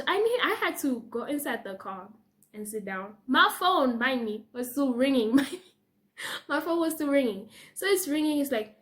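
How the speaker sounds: ordinary delivery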